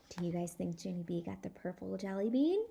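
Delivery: ordinary delivery, nothing marked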